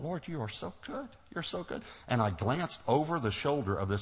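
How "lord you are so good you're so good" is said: high, soft awe, retold; hushed, high-pitched thankful prayer; awed gratitude. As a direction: marvel softly to the Lord in a raised pitch. Retold grateful thought to the Lord.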